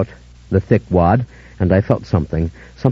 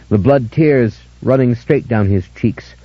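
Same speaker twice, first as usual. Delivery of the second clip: ordinary delivery, nothing marked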